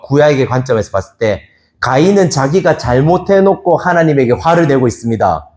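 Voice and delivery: ordinary talk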